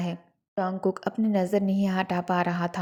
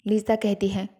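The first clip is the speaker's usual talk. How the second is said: plain reading voice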